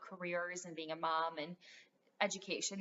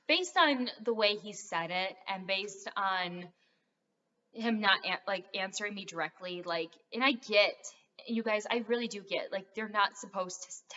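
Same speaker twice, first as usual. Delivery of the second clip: ordinary talk, nothing marked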